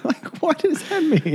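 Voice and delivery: high laughing disbelief. Real, not acted. Cohost laughing at the silly Duolingo phrase, high and giggling. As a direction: squeal it out laughing. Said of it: "laughing question".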